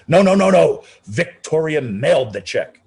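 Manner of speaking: desperate to stop them; frantic protest. Retold or imitated. Maestro pleading with the movers over his piano, loud and frantic.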